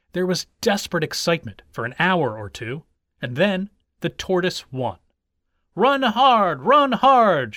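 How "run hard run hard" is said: high, loud crowd cheer; shouting. A narrator's reading. Some onlookers urge the tortoise on, shouting. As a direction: yell it twice to spur on the runner. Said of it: "shouted his backers".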